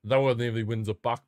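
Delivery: ordinary talk, nothing marked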